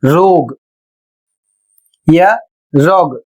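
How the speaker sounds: रोग said "roag" with a long o and a g; common mispronunciation. Shown as a demonstration. Teacher imitating the learners' mistake, रोग as roag. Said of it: "बहुत लोग इसे पढ़ते हैं".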